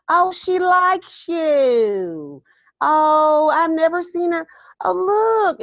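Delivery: put-on very high, very slow, drawn-out sweet old lady; older Southern lady. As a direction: coo it high and slow like a doting Southern grandma. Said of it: "She was this older Southern lady".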